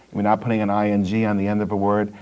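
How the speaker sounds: ordinary talk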